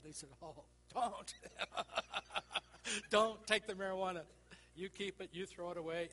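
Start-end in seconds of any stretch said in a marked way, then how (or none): 0.4-3.1 laughing through a mock warning; amused mock-stern warning. Officer's laughing warning retold. 3.1-4.2 Don't take the marijuana, punched loud with a laugh; loud laughing warning. Retold or imitated. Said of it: "but anyway, don't take the marijuana". 4.7-6.1 laughing through a mock warning; amused mock-stern warning. Officer's laughing warning retold.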